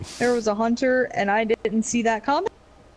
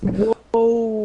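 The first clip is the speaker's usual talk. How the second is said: trance-like whoa; 4 dB louder than usual. Retold or imitated. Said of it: "sounds like he's in a trance".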